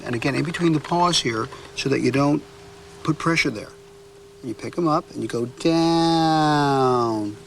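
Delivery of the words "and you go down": down drawn out very slowly; elongated down. Shown as a demonstration. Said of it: "So you elongate the down".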